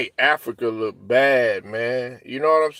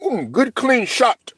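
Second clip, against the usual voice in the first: high, slow mock voice of a polite rival; imitating African teammate; 9 semitones higher, slower than usual. African teammate praising the elbow, high mock voice. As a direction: play the too-polite opponent, pitched way up. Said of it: "he'd be like".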